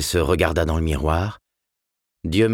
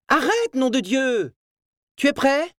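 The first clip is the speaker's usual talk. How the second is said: voix aiguë et lente d'une mère à bout; crié excédé. A narrator's reading. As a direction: crier à son fils d'arrêter la batterie. Mère excédée crie vers l'étage. (mère excédée qui crie)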